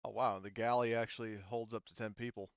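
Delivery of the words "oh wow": ordinary talk, nothing marked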